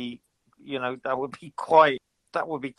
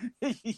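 laughing; 9 semitones higher, 10 dB quieter than usual. Real, not acted. Amused by the jab.

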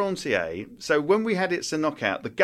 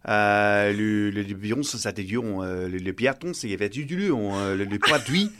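made-up French gibberish, lower, hesitant; fake foreign language; 5 semitones lower than usual. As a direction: invent French-sounding nonsense with French sounds. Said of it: "Can you do a bit now?".

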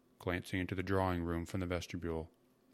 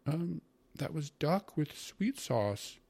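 slow, higher-pitched meek answer; soft-spoken mother; 9 semitones higher, much slower than usual. A narrator's reading. Mother explains the dish to her brother, soft answer. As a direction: answer slowly and gently, pitched up. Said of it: "Mother answered softly".

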